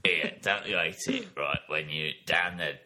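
low Cockney God working a crowd; hammed-up East End comic deity; stand-up comedian God. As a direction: deliver it as a Cockney stand-up's opening gag. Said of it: "God was a stand-up comedian".

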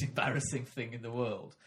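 ordinary delivery

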